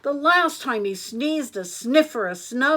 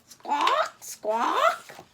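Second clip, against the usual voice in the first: squawk; 6 semitones higher than usual. A narrator's reading. Bird calls in story.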